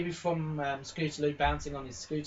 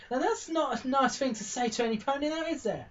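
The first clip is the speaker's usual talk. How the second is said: much higher, sing-song chiding pony voice; Sweetie Belle scolding; 10 semitones higher than usual. Acted. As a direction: tell off the bully like a prim little filly. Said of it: "Sweetie Belle just comes up to her".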